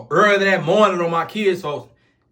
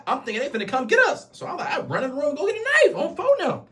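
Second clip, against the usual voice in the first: alarmed retelling; 10 semitones higher than usual. Retold or imitated. Panics as the rival gang arrives.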